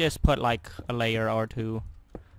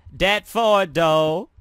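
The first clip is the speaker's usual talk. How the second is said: emphatic; 6 semitones higher, 8 dB louder, much slower than usual. Real, not acted. Loud emphatic repeat.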